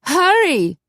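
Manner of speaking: high, loud urgent cry; urgent call. Acted. Corky urging her lover into the room, high urgent call. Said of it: "Corky opened the door".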